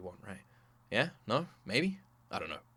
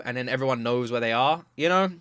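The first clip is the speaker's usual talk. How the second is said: normal talking voice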